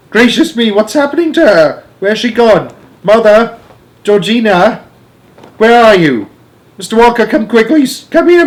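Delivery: high, loud, frantic cries; panicked calling. A narrator's reading. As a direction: call for help in a flap. Mother vanishes, frantic calls for help.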